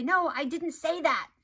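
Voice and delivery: defensive protest. Acted. Accidentally invalidating someone by denying their hurt, defensive. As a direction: protest it loudly, as if wrongly accused.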